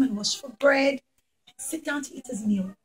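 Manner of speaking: plain reading voice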